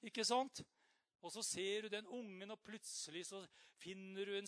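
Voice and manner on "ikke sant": ordinary delivery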